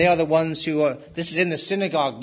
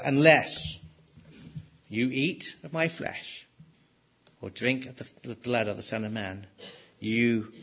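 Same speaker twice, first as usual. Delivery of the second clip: softer, lower retelling, the words of Jesus almost under the breath; hushed warning; 5 semitones lower, 6 dB quieter than usual. Acted. Preacher repeating Jesus' warning to the congregation, hushed. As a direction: let it sink in quietly. Same warning retold softly.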